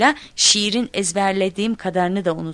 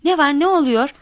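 high, louder, urgent questions; alarmed questioning; 8 semitones higher, 5 dB louder than usual. A narrator's reading. Teachers rushing in at the noise, alarmed. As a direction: ask in a worried rush of concern. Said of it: "telaşla geldiler".

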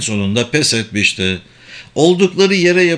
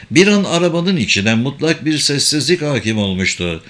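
plain reading voice

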